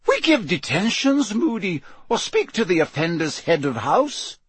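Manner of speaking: high, urgent correction; protesting McGonagall. Acted. McGonagall correcting Moody on punishments, high protest. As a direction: insist in a high, dismayed voice.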